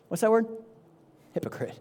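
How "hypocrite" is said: quiet accusation. Acted. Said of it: "Jesus says these two words to you".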